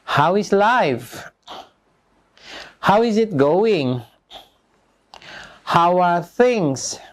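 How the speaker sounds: slow drill questions with long pauses; slow language-teacher modelling; repeat-after-me model. Shown as a demonstration. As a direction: stretch each word for learners to copy. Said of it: "Diulang ya sesudah saya".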